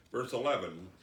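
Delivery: ordinary talk, nothing marked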